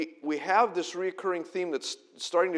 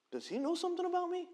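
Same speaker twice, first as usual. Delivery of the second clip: high, quick, puzzled mock voice; mock puzzled brother; 4 dB quieter, faster than usual. Preacher voicing the brothers' missed suspicion, high and quick.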